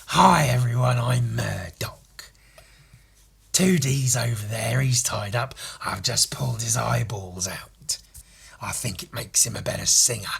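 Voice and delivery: whispery rasp of a menacing cartoon host; Murdoch. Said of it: "a whole episode as Murdoch".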